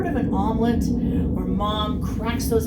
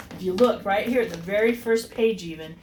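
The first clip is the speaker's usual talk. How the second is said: ordinary delivery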